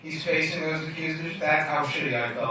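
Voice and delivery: ordinary talk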